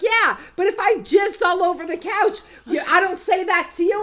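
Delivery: imitated arguing. Brother defending himself to his sister on the phone, arguing. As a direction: mimic someone loudly defending himself.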